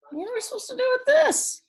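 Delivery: high, bewildered little pig; puzzled pig. A narrator's reading. Pigs asking the hens about the brushes, high and baffled.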